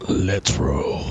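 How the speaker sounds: very deep, epic movie-hero voice. Shown as a demonstration. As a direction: drop it way down low for an epic line. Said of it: "Let me do it epically".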